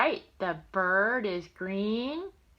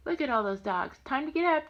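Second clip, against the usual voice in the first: usual speaking voice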